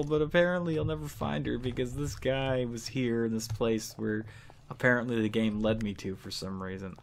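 ordinary talk

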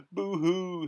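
high, hammed-up boo-hoo; sobbing. A narrator's reading. Cowardly Lion bursting into tears, mock sobbing. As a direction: wail it like a storybook crybaby. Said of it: "he sobbed".

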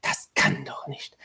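slow, unvoiced hiss under the breath. A narrator's reading. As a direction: hiss it softly in disbelief. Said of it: "zischte sie".

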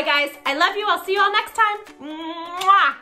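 usual speaking voice

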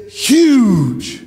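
lightly imitated campaign catchword, drawn out; Bernie Sanders. Preacher quoting a presidential candidate to the church, light imitation. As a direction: stretch the word in a mild mock of a politician. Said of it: "You know how Bernie said".

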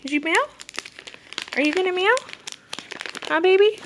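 high, loud, rising questions. Real, not acted. Owner asking cat about meowing, high baby talk.

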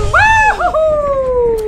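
cheer. Real, not acted. Mother cheering with daughter as they fly, high excited woohoo. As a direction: let out a happy flying cheer.

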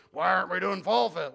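a hint of a whiny churchgoer; mock the accusing member; complaining churchgoer. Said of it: "As opposed to".

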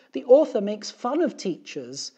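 high, loud complaining teacher; aggrieved teacher. A narrator's reading. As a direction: pour out a put-upon teacher's grievance. Upset teacher complains to three men.

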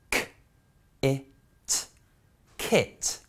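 K, I, S, then kiss said whole; sounding out. Shown as a demonstration. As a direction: sound out each letter then blend into kiss. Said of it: "blended in words for you".

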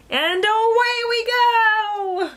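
high, long drawn-out cheer; joyful send-off. Acted. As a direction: sing it out slowly as you lift off. Takeoff refrain.

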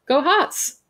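high fan's cheer; acted chirpy rallying cry; team cheer. Grinning fan cheers her team.

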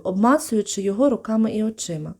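ordinary delivery, nothing marked

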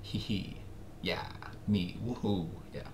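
ordinary delivery

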